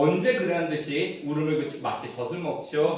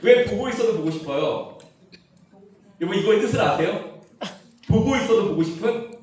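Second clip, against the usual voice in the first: playful teasing; 5 semitones higher, 4 dB louder than usual. Acted. Teasing the congregation.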